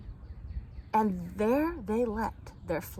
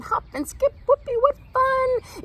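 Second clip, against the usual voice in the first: much higher, louder gleeful cry; joyful cheer; 7 dB louder than usual. Acted. Root children dancing in the summer meadow, gleeful.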